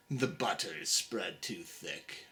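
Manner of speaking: low, soft gruff mutter; low grumble. Acted. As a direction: grumble under breath. Carpenter grumbles.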